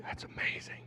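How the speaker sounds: put-on breathy hushed awe over a child's card; hushed mock-amazed whisper; whispered praise. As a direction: whisper it so the kid can't hear.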